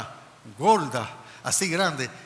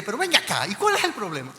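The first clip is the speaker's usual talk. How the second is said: raised, higher irritated challenge; angry; 5 semitones higher, 5 dB louder than usual. Retold or imitated. Applicant confronting the lieutenant who will not help, angry. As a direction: re-enact losing your temper and confronting someone. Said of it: "Yo me enojé, yo me airé".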